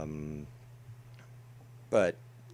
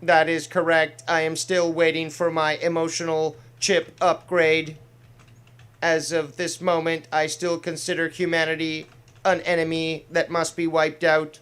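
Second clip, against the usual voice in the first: louder, stiff android voice; 3 semitones higher, 6 dB louder than usual. Pretend. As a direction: speak as a cold android. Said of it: "my little impressions there".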